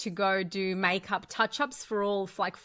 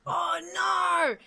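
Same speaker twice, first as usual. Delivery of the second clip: horrified oh no; 8 semitones higher than usual. Retold or imitated. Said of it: "I was like".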